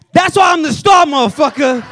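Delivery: high, loud cocky yell; yelling at little brother. Retold or imitated. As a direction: brag it at the top of your lungs. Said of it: "Then I yelled at my little brother".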